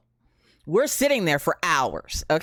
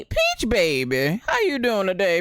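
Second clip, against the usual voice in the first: ordinary talk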